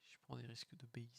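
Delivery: breathy unvoiced whisper. Real, not acted. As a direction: keep it under your breath. Hidden, whispers to himself.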